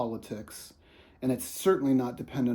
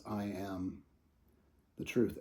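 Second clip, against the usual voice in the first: quiet declaration; 4 semitones lower, 7 dB quieter than usual. Shown as a demonstration. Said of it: "And notice this".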